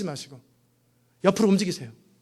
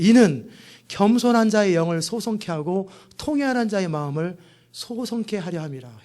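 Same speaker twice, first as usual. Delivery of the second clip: scripture reading; 2 semitones lower than usual. Acted. Scripture quoted in sermon.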